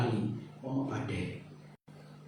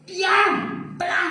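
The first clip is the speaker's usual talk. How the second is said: high, loud shout of Diam, tenang; loud rebuke; 5 dB louder than usual. Shown as a demonstration. Showing the congregation how Jesus rebuked, loud high shout. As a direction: bark Diam, tenang at the storm. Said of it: "bahasa yang benar ya".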